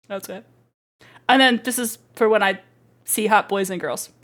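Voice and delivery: normal talking voice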